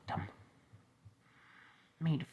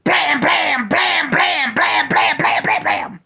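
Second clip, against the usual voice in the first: shouted gunfire; 14 semitones higher, 16 dB louder, slower than usual. Acted. Rapid gunfire sound effects.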